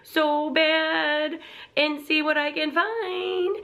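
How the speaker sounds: high, slow longing whine; eager inner voice. Retold or imitated. Shopper eager to go to HomeGoods, high, slow and longing. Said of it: "and I'm like".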